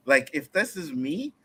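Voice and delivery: normal talking voice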